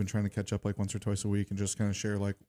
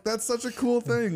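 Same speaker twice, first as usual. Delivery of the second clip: laughing delight; 5 dB louder than usual. Retold or imitated. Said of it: "I was like".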